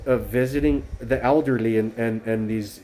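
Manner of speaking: usual speaking voice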